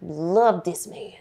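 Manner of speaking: very slow, each word weighed; dramatic slow build. Real, not acted. Advising women about heartbreak, slow and deliberate. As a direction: lay it out slowly for effect.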